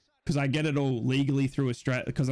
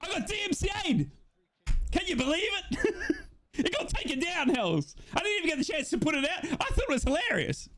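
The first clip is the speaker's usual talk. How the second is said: much higher, laughing through the words; real giddy outrage, laughing as you tell it; excited laughing disbelief. Real, not acted. Laughing disbelief about video takedown.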